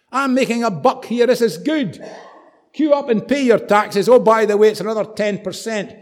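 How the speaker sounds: high, loud, self-satisfied crowing; greedy tax collector. Acted. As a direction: play a brazen taxman working the queue. Voicing greedy tax collector's pitch.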